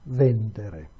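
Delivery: vendere stressed on the first syllable, VEN-de-re; stress on antepenultimate. Shown as a demonstration. Teacher contrasting vendere's stress for students. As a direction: lean on VEN in vendere, lightly. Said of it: "instead on the third to the last syllable".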